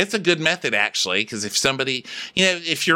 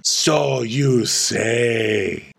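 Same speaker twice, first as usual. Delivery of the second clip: very slow, drawn-out disdain; Diana; 3 semitones lower, much slower than usual. Acted. Diana doubting Sutton, slow theatrical disdain. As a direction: stretch every word dismissively. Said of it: "I'll be Diana".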